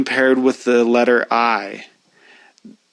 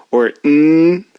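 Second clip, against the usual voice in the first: hummed mm through the nose, a bit louder; nasal hum; 3 semitones higher, 4 dB louder than usual. Shown as a demonstration. Teacher humming mm to show nasal sound. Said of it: "can you hear it coming through the nose".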